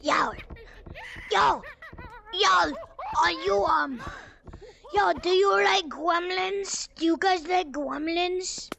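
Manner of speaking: loud gremlin calling out; shouting yo. Acted. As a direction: yell to grab the players' attention. Yelling at players in chat.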